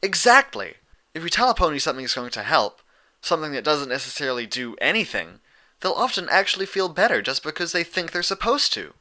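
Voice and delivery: excited, speeding up. A narrator's reading. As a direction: rattle it off as excitement builds. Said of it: "her words coming faster and faster".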